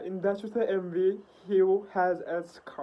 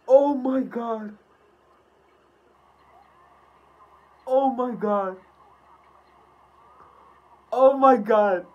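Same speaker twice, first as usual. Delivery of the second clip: overwhelmed exclamations; 4 semitones higher, 8 dB louder than usual. Real, not acted. Overwhelmed reaction to scene.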